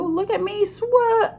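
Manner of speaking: high, slow squeak cut off; squeaky Roo. Acted. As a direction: squeak and break off mid-word.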